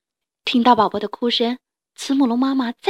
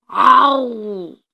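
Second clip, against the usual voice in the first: a loud, drawn-out roaring cry; dinosaur roar; 6 dB louder than usual. A narrator's reading. Tyrannosaurus springs from behind the rock, loud roar. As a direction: roar like a pouncing beast.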